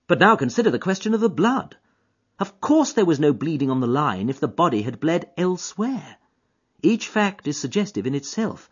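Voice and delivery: keen detective to his friend; Holmes voice. Acted. Holmes to Watson on the train, eager and keen.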